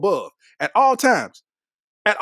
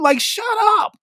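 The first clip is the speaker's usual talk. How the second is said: dismissive; 12 semitones higher than usual. Real, not acted. Dismissing a LeBron hater.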